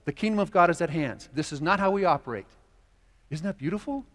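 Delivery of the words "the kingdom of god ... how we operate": higher, louder voice of Jesus; Jesus calling the disciples onward, louder; voicing Jesus. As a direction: urge them on as Jesus. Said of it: "Jesus says".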